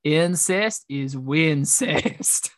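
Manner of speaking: very slow, drawn-out tipsy Southern drawl; drunk Southern friend. Retold or imitated. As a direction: stretch each word like a sozzled Southerner. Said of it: "She was drunk one day and sent me a voice message".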